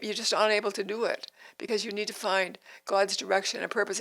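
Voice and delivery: ordinary delivery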